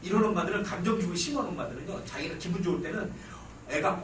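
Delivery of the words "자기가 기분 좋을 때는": usual speaking voice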